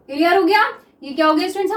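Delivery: normal talking voice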